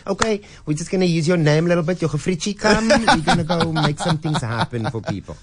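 breezy put-on sales pitch; imitating Brian. S0 imitates Brian recruiting him, high and fast. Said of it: "and then he goes".

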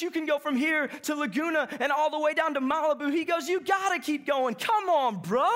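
a hint of a hyped surfer egging him on; eager urging.

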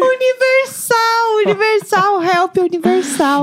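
high, slow drawn-out joking call; mock announcer cry. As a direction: stretch it out like a grand proclamation. Co-host jokes in high voice. (grito agudo e arrastado de brincadeira)

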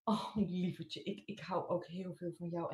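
tender mother. Acted. Mother touched by child's love.

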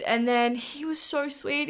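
usual speaking voice

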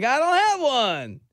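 slow, high-pitched mock protest; imitated celebrity protest. Maskless celebrity to a staring restaurant, mock protest.

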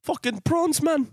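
high-pitched South African film imitation; caricatured South African exclamation; mock South African accent. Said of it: "District nine".

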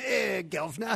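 put-on Cockney street urchin; Cockney accent. Co-host showing his Dickens urchin role, hammed-up Cockney accent. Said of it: "a Cockney accent".

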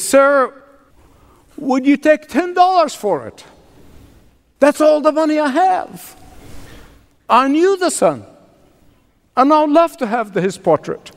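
a timid housekeeper offering all her money; mild tremble of a shy servant asking; halting, shaken. Retold or imitated. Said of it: "in a halting voice, shaken".

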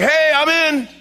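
over-the-top eager shout; gung-ho Peter. Acted. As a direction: holler it like a keen volunteer. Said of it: "And Peter's like".

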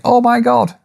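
quick high mock exclamation; mock shock. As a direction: throw up a pretend gasp of surprise. Quoted Klopp imagining a shock.

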